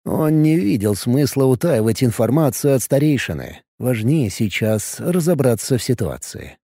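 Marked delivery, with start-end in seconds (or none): none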